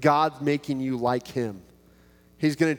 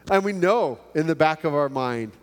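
ordinary talk

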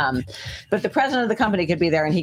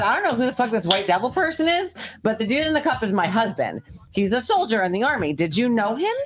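retold indignant reply; 7 semitones higher than usual. Her own retold reply.

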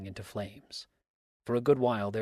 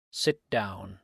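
deep level voice; 5 dB louder than usual. A narrator's reading. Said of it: "in that deep, level voice".

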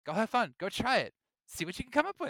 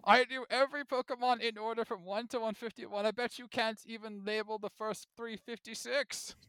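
crotchety grandpa scolding, louder; grumpy old man; 6 semitones higher, 10 dB louder than usual. Pretend. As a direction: rant like an indignant old-timer. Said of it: "you whippersnapper".

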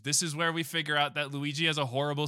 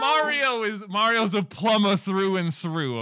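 laughing while talking; 5 semitones higher than usual. Real, not acted.

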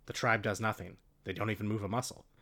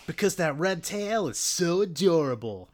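higher, louder mock-cute coo; 6 semitones higher, 6 dB louder than usual. Marco teasing Jake about his red tail, mock cooing. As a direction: gush over the tail to tease. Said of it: "Marco answers".

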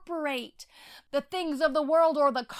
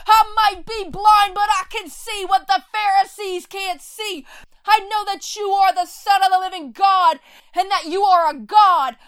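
full-throated higher, louder preaching cry; 4 semitones higher, 10 dB louder than usual. Real, not acted. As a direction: cry out to Jesus with everything you have. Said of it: "I'm crying out with everything that is within me".

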